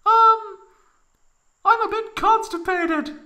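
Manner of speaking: high falsetto, a touch embarrassed; sheepish Tifa voice. Acted. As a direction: admit it in a high, sheepish girl voice.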